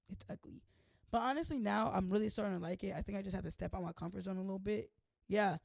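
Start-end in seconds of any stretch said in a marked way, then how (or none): 0.1-0.6 soft unvoiced whisper; whispered confession. Owning up to calling the frame ugly, whispering. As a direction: breathe it out guiltily. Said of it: "what did I say?".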